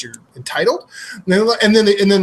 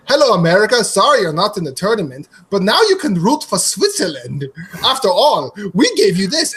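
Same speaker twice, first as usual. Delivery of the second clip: higher-pitched mock Swiss accent; 3 semitones higher than usual. Swiss guy greeting America in a car commercial, mock Swiss accent. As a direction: put on a hammed-up Swiss accent. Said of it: "I'm gonna do an accent".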